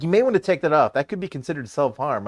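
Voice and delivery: high, loud mock concern; urgent warning. Mod friend warning about the chug button, urgent. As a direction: warn them off with exaggerated concern.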